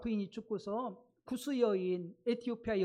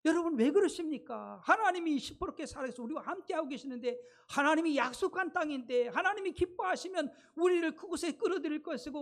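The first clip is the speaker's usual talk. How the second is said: higher and louder, urging the crowd; exasperated pleading; 5 semitones higher, 3 dB louder than usual. Retold or imitated. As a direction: plead with frustrated conviction, voice raised. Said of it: "갈렙이 막 너무 답답하니 자기 옷을 찢어버리면서".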